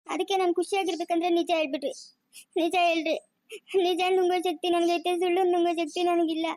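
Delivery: tearful begging for the truth; crying plea. Acted. Mother begs her daughter for the truth about her son, crying. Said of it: "ಹ್ಞೂನಮ್ಮ, ಅಳ್ಬೇಡಮ್ಮ".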